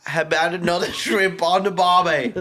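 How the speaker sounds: high, loud mock Australian; cartoonish Australian accent; attempted Australian accent. Shown as a demonstration. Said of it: "I don't know, Australian".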